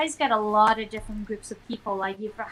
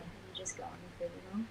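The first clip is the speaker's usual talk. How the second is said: whisper; 3 semitones lower, 16 dB quieter, much faster than usual. Real, not acted. Aside whispered.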